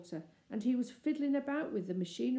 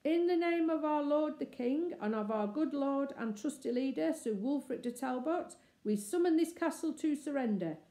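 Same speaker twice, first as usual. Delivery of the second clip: herald's summons; 6 semitones higher than usual. Acted.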